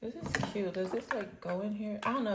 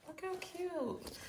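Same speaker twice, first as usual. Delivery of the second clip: soft, high, slow coo; delighted cooing; 10 semitones higher, 6 dB quieter, slower than usual. Real, not acted. Showing viewers the cute bag, delighted cooing. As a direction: coo softly up high over something adorable.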